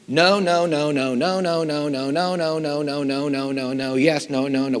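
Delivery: loud, brisk chant of no after no; rapid-fire rejections. Pretend. Composer mimicking rejection after rejection, loud and brisk. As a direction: fire off each no like a rejection stamp.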